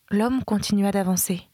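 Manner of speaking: ordinary delivery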